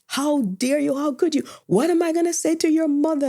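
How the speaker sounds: imitating an uncle scolding a runaway niece, voice climbing; mock furious uncle, high and rising; uncle's angry retort. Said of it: "He was so mad with me".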